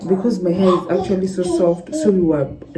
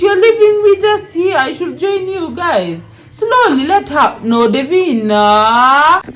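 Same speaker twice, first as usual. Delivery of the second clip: high, raised-voice protest; loud plea to wait up; calling after them; 7 semitones higher, 4 dB louder than usual. Real, not acted. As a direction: call out to those leaving you behind. Calls after those leaving her behind.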